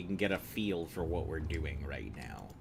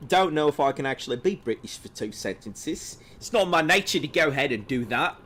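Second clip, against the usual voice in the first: higher, louder mock British accent; put-on British accent; 7 semitones higher, 7 dB louder than usual. American streamer protesting a request to be British, in a put-on British accent. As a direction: play an American badly pretending to be a Brit, pitched up. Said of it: "be British for, like, two sentences".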